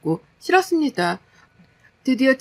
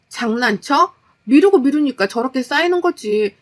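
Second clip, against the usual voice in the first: annoyed wife building to a loud plea; fed-up, angry wife; 3 semitones higher, 5 dB louder than usual. Acted. Exhausted wife telling husband to stop messing, exasperated.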